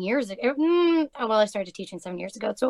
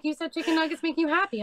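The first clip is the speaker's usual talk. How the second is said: put-on quick, higher child's voice; kid voice; 7 semitones higher, 3 dB quieter, faster than usual. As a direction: imitate an earnest little kid. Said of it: "He was like".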